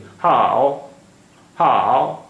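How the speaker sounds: long हाओ dipping down, then rising; third tone. Shown as a demonstration. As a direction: draw हाओ out, falling then lifting. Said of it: "नीचे आ रही है फिर ऊपर जा रही है".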